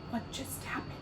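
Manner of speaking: whispered, stunned question; astounded whisper. Shown as a demonstration. Showing what astounded means, whispered shock. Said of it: "Astounded means like".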